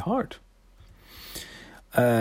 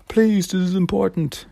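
higher, earnest pleading voice; pleading Silas; 9 semitones higher than usual. Acted. Silas explaining to Jenny he searched, higher pleading voice. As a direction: beg her to understand.

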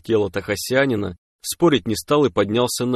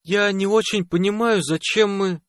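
much higher-pitched female character voice; voice of a puzzled woman; 11 semitones higher than usual. Acted. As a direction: pitch it high, a puzzled question. Narrator voices Aina starting a question. (высокий женский голос, с недоумением)

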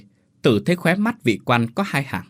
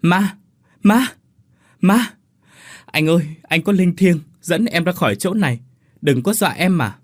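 high, loud cries of alarm easing into pleading; acted panic at seeing a ghost; frightened yell; 6 semitones higher, 4 dB louder than usual. A narrator's reading. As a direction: yelp in fright, then beg the ghost. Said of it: "rồi la lên".

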